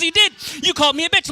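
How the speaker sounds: hammed-up angry girlfriend; accusing shout. Girlfriend accusing her partner, mock angry shout.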